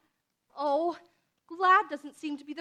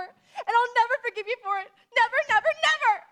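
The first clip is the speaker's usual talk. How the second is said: defiant vow; 4 semitones higher than usual. Acted. Girl swears to the neighbour she will never forgive her, furious. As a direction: build to a furious final never.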